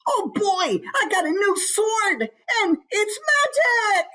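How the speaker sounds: put-on very high, squealing young halfling; high-pitched wide-eyed glee; so excited. Shown as a demonstration. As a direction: burst out like a thrilled little kid. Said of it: "maybe he's just so excited".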